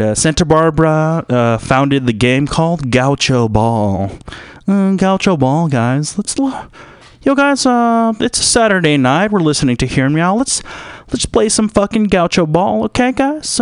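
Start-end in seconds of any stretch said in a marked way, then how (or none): 6.2-13.6 high, hyped party host; rally the party to play; hype. Acted. Host hyping friends to play Gaucho Ball, excited.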